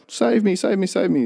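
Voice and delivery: high-pitched retold cries for help; frantic pleading, lightly acted; mock panic. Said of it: "they're freaking out".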